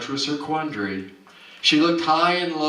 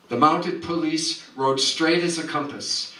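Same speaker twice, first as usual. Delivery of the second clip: plain reading voice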